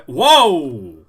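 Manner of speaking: loud, startled whoa; startled shout. Real, not acted. Streamer surprised mid-sentence by a catch, loud startled shout. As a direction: burst out in surprise.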